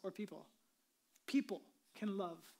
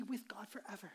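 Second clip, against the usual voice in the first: whisper; 2 semitones lower, 7 dB quieter than usual. Acted. Hushed conclusion.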